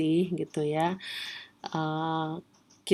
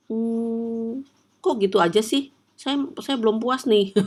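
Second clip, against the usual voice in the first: higher, whiny complaining customer; unsatisfied customer; 5 semitones higher than usual. Retold or imitated. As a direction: act out a customer who is not satisfied. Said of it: "Ada orangnya kayak gitu". (pelanggan yang merengek tidak puas)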